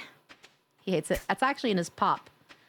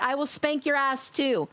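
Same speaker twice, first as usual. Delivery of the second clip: ordinary talk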